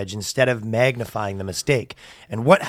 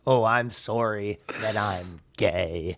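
drawn-out mock apology; 4 semitones lower, 5 dB quieter, much slower than usual. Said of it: "mock apology".

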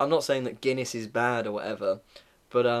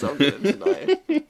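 mock defiance; 11 semitones higher, 5 dB louder than usual.

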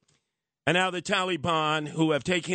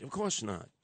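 ordinary talk

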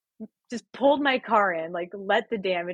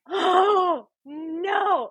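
high, loud, mock-tearful cry; drawn-out, high lament; dismayed wail; 11 semitones higher, 4 dB louder than usual. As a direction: wail in hammed-up despair. Acts out realising the huge loss.

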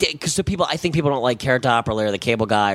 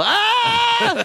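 high yelping scream; stage-bit yelp, pitched way up; mock scream. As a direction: let out a sudden shrill-pitched yelp. Dick-out closer scream.

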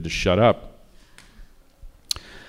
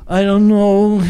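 much higher, louder anguished whine; Montgomery Clift mumble; 10 semitones higher, 10 dB louder, slower than usual. Retold or imitated. Director imitating Montgomery Clift on screen, loud anguished whine. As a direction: play the tortured actor, overdone. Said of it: "he's like".

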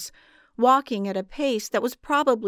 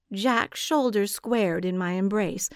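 usual speaking voice

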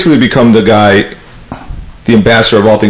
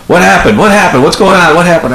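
frantic roommate; 7 semitones higher than usual. Retold or imitated. Rich bursting in, concerned.

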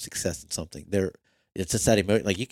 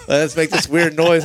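high, loud burst of excitement; high, loud excited burst on finding the deer; weird excited noise; 9 semitones higher, 9 dB louder than usual. Retold or imitated. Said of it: "my face expression and noise was so weird".

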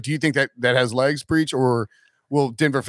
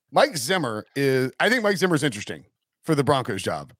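usual speaking voice

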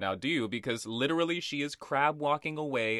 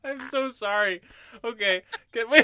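helpless laughter; 8 semitones higher, 4 dB louder than usual. Real, not acted. Apologizes amid laughing fit.

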